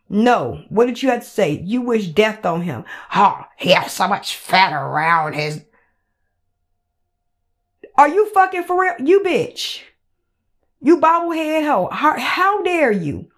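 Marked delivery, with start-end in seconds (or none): 3.1-5.6 mock quote. Mocking her cruel remark. 7.9-13.3 outraged outburst. Real, not acted.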